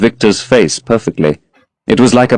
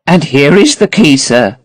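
put-on higher, fluttery old servant voice; old housekeeper; 6 semitones higher than usual. A narrator's reading. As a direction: hand over the key, a bit flustered. Voices Mrs Leaf handing key.

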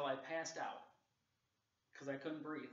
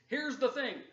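Joe Biden impression; 10 semitones higher, 13 dB louder, slower than usual. Shown as a demonstration. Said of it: "I sound like Joe Biden".

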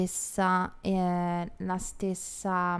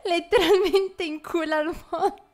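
high, loud words through real laughter; helpless laughing delivery; 11 semitones higher, 6 dB louder than usual. Real, not acted. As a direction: crack up while saying it. Cracking up at her tiny country.

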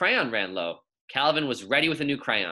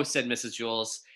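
usual speaking voice

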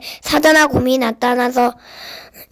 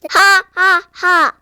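slow, scornful giant laugh; mocking giant laugh; 4 semitones higher, much slower than usual. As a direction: laugh down at the boy. Goliath mocks David.

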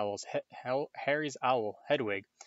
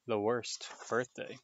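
ordinary delivery, nothing marked

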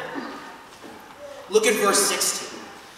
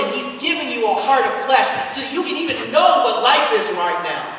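ordinary delivery

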